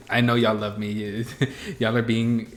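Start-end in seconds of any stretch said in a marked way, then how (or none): none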